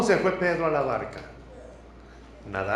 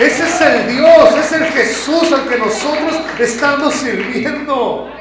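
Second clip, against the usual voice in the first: builds from strong to shouted, louder and higher; preaching at full force; 6 semitones higher, 9 dB louder than usual. Acted. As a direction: preach it, rising to a roaring climax.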